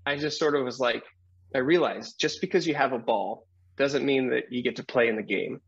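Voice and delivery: ordinary talk, nothing marked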